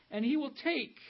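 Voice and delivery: high, loud emphasis on "take"; stress on take. Shown as a demonstration. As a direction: make "take" ring out before a pause. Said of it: "did you notice the emphasis on the word".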